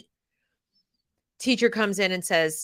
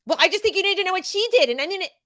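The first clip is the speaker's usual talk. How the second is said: higher, fast, mocking teacher voice; snippy teacher; 9 semitones higher, faster than usual. Mom mimicking the teacher's smug phone call, higher and fast. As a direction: rattle it off like a smug young teacher.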